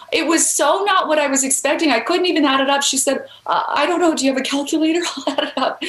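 retold bewilderment, high-pitched through laughter; amused disbelief. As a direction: laugh while imitating someone too stunned to do sums. Retelling a stunned reply, laughing.

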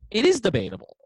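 「is」を強く高く押し出して言う; is debatable, with is hit hard and high; stress on IS. Real, not acted. Correcting the host's claim.

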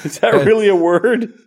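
high, loud, laughing question; loud high-pitched amused query; amused disbelief. Real, not acted. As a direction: ask it incredulously, fresh from a laughing fit. Still laughing at the word.

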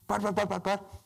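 high-pitched, flustered stammer; imitating someone lost for a reply; mock sputtering. Imitates the flustered manipulator's protest.

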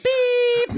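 very high, loud squeaky beep; imitated beep. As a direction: beep like an attention buzzer. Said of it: "teachers beeped like me".

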